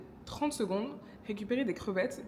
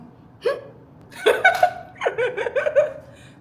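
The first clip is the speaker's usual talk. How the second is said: loud high laugh; 13 dB louder than usual. Real, not acted. Watching her own clip, laughs loud and high. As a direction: burst of high delighted laughter.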